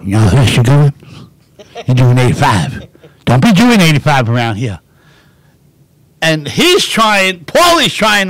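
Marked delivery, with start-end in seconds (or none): 0.0-4.8 loud, lightly voiced stern trooper; a hint of a small-town cop; state trooper voice. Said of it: "and it was like".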